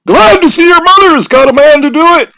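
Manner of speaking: high, loud praising villagers; crowd voices. A narrator's reading. Crowd praises the nervous boy, high and loud. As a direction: cheer the boy on. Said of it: "said things like".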